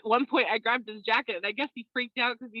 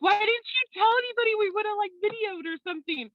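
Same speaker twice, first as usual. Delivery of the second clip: mock photographer; 12 semitones higher, 3 dB louder than usual. Photographer quoted, wishing to film.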